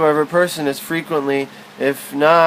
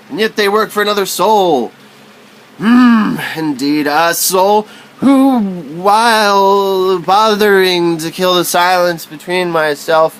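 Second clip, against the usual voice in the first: soaring, louder poetic declamation; dramatic declamation; 5 semitones higher, 3 dB louder than usual. Acted. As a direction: proclaim it like a stage poet. Declaiming poem burst.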